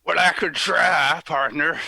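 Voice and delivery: lazy cowboy Oklahoma voice; Oklahoma accent. Pretend. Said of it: "Can you sound like someone from Oklahoma?".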